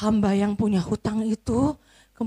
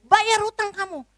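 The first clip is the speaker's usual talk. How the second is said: much higher, harsh order while grabbing him; forceful demand; 9 semitones higher, 3 dB louder than usual. Acted. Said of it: "sambil dipaksa gitu".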